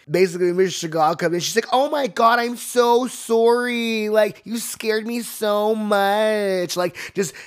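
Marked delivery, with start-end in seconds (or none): 1.7-6.8 put-on high, drawn-out Valley girl accent; California Valley girl. Startled receptionist apologising, California Valley girl accent. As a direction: gush in a loud, stretched Valley girl voice. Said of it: "this California Valley girl".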